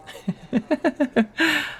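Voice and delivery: a light high chuckle; high laugh. Acted. Firefighter laughing after offering to fight the insurer, high laugh. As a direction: laugh up high, amused at yourself.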